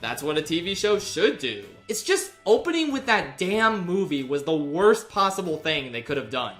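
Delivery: ordinary talk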